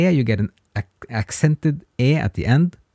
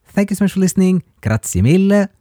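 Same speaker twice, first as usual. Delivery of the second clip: bright sign-off; 8 semitones higher, 5 dB louder, faster than usual. Real, not acted. Warm sign-off to listeners.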